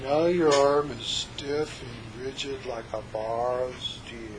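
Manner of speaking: slow, soft mismatched voice; wrong soft delivery. Shown as a demonstration. Hypnotist showing the wrong voice for stiff arm, slow and soft. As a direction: say the command limply. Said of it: "I don't go".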